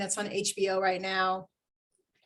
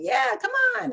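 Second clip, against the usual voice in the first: friends' cheer; 12 semitones higher than usual. Retold or imitated. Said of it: "they were like".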